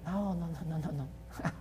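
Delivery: quiet, tender head-shaking no's; soft, patient denial; soft repeated no. Retold or imitated. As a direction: hush it gently, like calming a guilty child. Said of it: "Jesus shakes his head and said".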